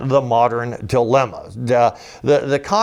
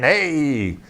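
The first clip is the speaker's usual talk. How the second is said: mock cop command; 2 semitones higher, 3 dB louder, much slower than usual. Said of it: "law enforcement comes in and saying".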